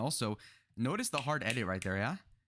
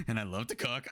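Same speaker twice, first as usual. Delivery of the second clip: mock date line. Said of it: "the bitch goes like".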